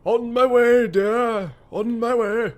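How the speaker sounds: high, loud sing-song reply; lightly voiced sweet old lady. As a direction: chirp it like a doting granny. Answering in a put-on voice.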